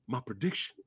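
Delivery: low, soft, menacing one-word threat; a low, hushed imitated boxer's threat; menacing Clubber quote. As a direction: growl it out quietly as the brute. Reenacting Clubber Lang's interview line.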